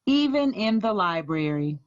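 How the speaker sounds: read-aloud picture book voice, unhurried; story narration. Acted. School librarian reading Library Lion aloud to children, gentle storytelling. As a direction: tell the story to a room of kids.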